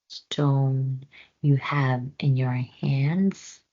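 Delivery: slow hissing whisper; snake whisper. Acted. Snake asks Floki for the stone, slow whisper. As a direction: whisper it slowly like a sly snake.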